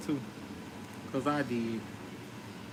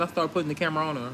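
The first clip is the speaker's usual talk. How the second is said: normal talking voice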